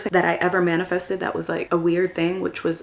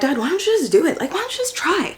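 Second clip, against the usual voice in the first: pleading push; 9 semitones higher, faster than usual. Retold or imitated. Retelling pushing dad to try.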